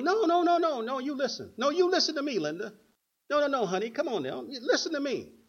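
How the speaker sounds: high, loud mimicry of an insistent little boy; bossy child. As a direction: cut in, acting like a kid lecturing mom. Acts out boy lecturing his mother.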